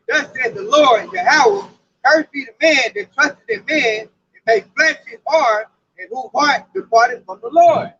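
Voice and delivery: slow, solemn scripture read. Acted. Preacher reading Jeremiah aloud to listeners, slow and solemn.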